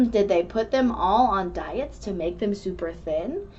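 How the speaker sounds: usual speaking voice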